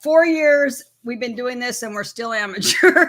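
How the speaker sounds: tease, then crack up laughing. Real, not acted. Teasing partner about four years of streaming, laughing. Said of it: "Sorry, I'm laughing so much".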